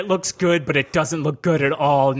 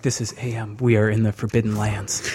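deep radio announcer; 7 semitones lower than usual. Retold or imitated. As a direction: play a solemn shortwave radio host. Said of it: "We'll be like".

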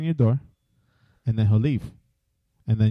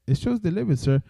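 a higher, lightly voiced customer-service agent; delivery agent; 7 semitones higher than usual. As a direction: play the polite agent reading off the screen. Retold call with delivery agent.

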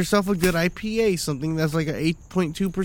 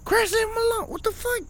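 high mock crowd; 3 dB quieter than usual. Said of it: "And everyone's like".

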